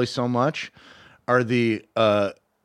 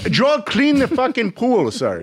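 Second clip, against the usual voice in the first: high, barked mock order; tough Israeli dad; 11 semitones higher, 4 dB louder than usual. Israeli dad ordering his kid around, mock angry voice. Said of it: "hardcore Israeli tough guy".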